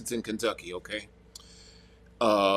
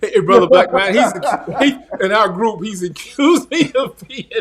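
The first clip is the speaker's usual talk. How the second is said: high, loud laughing tease; laughing hard as he teases; 8 semitones higher, 7 dB louder than usual. Real, not acted. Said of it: "laughing while teasing brother".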